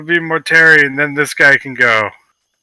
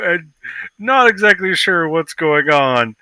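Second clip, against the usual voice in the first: normal talking voice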